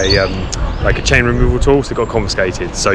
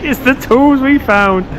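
jubilant; 11 semitones higher than usual. Real, not acted. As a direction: celebrate the found tools. Tools found, jubilant high voice.

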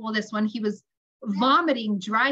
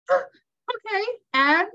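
anxious prompting; 9 semitones higher, 3 dB louder, much slower than usual. Retold or imitated. Anxious mother prompting son to continue.

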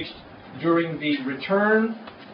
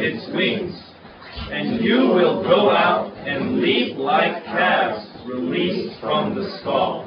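slow, emphatic unison Bible reading; drawn-out scripture read aloud together; congregational reading; 3 semitones higher, 4 dB louder, slower than usual. Real, not acted. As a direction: lead the congregation through the verse, slowly.

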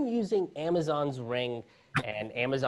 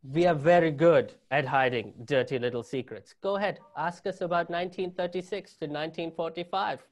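playful mock German accent; a sly German, dodging the question; 3 semitones higher, 4 dB louder than usual. German authorities feigning innocence, in a mock German accent. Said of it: "the best German accent you guys are gonna get out of me".

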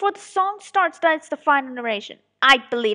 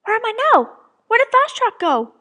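calling out; 5 dB louder than usual. Acted. Acted scene calling out.

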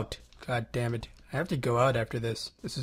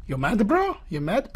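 mocking taunt; 8 semitones higher than usual. Taunting meme line.

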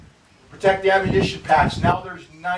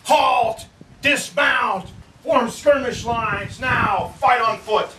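high, drawn-out military commands; screamed orders; 5 semitones higher, slower than usual. A narrator's reading. Reno orders his charging soldiers to halt, screaming out. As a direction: call the orders high over galloping horses. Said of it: "he screams out".

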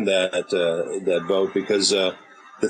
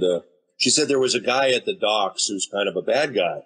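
normal talking voice

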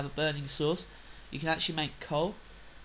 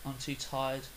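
ordinary delivery

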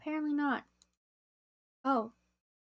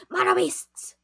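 higher, loud yell; excited shout; 9 semitones higher, 8 dB louder, slower than usual. Real, not acted. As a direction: call out the monster. Spots monsters again.

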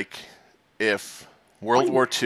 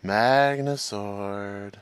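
slow drawn out; 4 semitones lower, 5 dB quieter, much slower than usual. Pretend. Slow dramatic naming.

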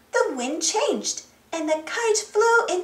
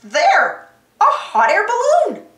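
loud, high excited shout; Boots shouting; 7 semitones higher, 6 dB louder than usual. A narrator's reading. Monkey spots the balloon for Dora, shouting. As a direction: shout out your discovery. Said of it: "shouted Boots".

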